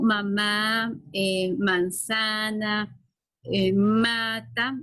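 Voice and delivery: mamá, manzana, mata drawn out very slowly; slow demo words. Shown as a demonstration. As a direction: stretch each word so the M stands out. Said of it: "Con este sonido".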